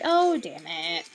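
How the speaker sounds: slow, low, drawn-out mock dismay; mildly annoyed, not panicking. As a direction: groan it like a recurring nuisance. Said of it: "instead of being, like, freaking out".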